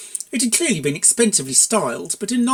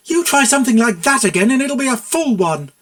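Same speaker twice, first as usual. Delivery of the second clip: higher, louder furious warning; angry threat; 8 semitones higher, 7 dB louder than usual. Acted. Mayor warning Buck after hurling the saucepan, furious. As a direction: threaten him hard.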